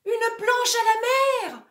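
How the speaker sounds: high, loud alarm cry; shout. Acted. Floating actor crying plank overboard. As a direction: call it out in panic. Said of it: "Il a envie de crier".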